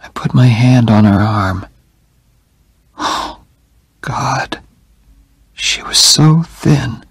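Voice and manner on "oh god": ordinary delivery, nothing marked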